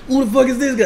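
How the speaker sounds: put-on a botched Irish accent, aiming for Conor McGregor; bad Conor McGregor accent. Co-host showing his failed Conor McGregor impression, bad Irish accent. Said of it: "on my Conor McGregor shit".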